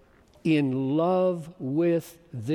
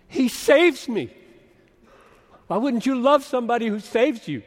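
fervent exclamation; 6 semitones higher, 7 dB louder than usual. Real, not acted. Preacher exclaims love for Paul.

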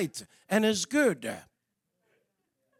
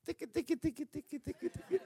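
put-on baby talk; 5 semitones higher, 10 dB quieter, faster than usual. Parent tickling baby, playful sounds.

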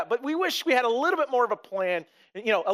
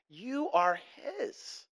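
soft and slow; 7 dB quieter, much slower than usual. Real, not acted. Pastor's quiet closing line.

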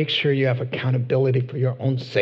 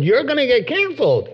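normal talking voice

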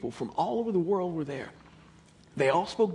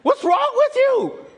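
high, loud exasperated outburst, slowing at the end; frustrated; 13 semitones higher, 10 dB louder than usual. Retold or imitated. Jesus scolding his puzzled disciples, loud exasperation. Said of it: "Jesus was so frustrated".

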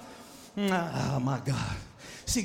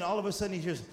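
usual speaking voice

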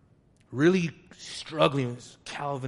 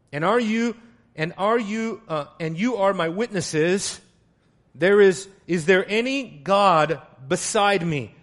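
loud, high, declaiming reading; preaching scripture; 6 semitones higher, 8 dB louder than usual. Acted. Preacher reading Isaiah to the congregation, loud and emphatic. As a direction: proclaim God's words to the church. Reads Isaiah with full force.

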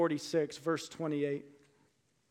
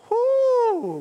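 loud, high whoop of delight; a preacher whooping at the text; excited whoop; 7 dB louder than usual. Real, not acted. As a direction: let out a joyful whoop. Preacher overwhelmed by the scripture.

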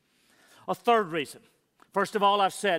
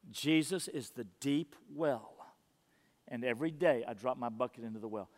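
soft reverent repeat; 7 semitones lower, 9 dB quieter than usual. Acted. Preacher to church, dwelling on the quote in a hush. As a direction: let the line sink in, hushed.

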